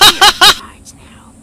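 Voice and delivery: triumphant shout. Acted. Skrit takes over.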